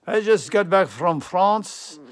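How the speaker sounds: hammed-up French greeting, loud and drawn out; playful fake French; mock French accent. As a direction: put on a jokey French accent. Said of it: "My accent is all messed up now".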